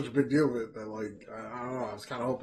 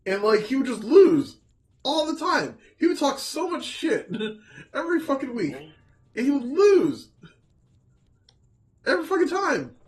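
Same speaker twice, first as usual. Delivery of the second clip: worked-up rant; 11 semitones higher, 7 dB louder than usual. Real, not acted. Heated rant about a wrestler.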